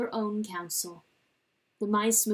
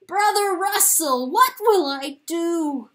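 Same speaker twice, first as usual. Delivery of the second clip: much higher, loud, slow pleading; desperate cry; 8 semitones higher, 8 dB louder than usual. Acted. Chanticleer begging the corpse for help, desperate and high. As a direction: beg the dead for an answer.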